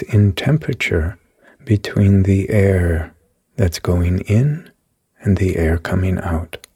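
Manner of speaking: calm, even meditation voice with long pauses; guided meditation. Acted. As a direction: lead the listener gently through each breath. Leads a guided body meditation.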